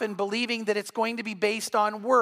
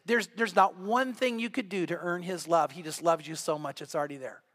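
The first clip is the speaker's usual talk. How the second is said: low, hushed sincerity settling softer; tender reassurance; 4 semitones lower, 4 dB quieter than usual. Real, not acted. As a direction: let it sink gently to a close. Reassuring listeners they are already loved.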